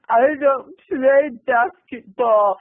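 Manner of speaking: slow, high mock sobbing; Marsha Brady. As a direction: wail it as if hit on the nose. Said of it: "like Marsha Brady when the football".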